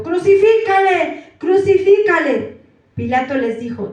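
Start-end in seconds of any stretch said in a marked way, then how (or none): 0.0-2.6 loud, high and slow demand; chanting crowd cry, louder and higher; crowd shout. A narrator's reading. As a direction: shout it like an angry mob. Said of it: "dieron voces diciendo".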